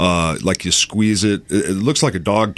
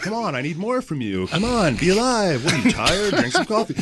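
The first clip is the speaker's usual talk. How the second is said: pushy producer; 11 semitones higher than usual. Retold or imitated. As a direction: needle him to give more energy. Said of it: "he's like".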